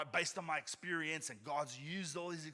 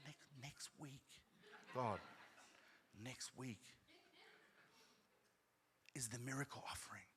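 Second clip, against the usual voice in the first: hushed, pleading with God; whispered plea to God; 12 dB quieter than usual. Retold or imitated. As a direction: plead under your breath.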